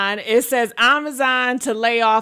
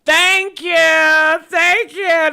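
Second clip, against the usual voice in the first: loud, high, drawn-out thank-you; exuberant thanks; 5 semitones higher, 5 dB louder, slower than usual. Real, not acted. Streamer thanks viewers who accept the yelling, loud and exuberant. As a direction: belt out your thanks.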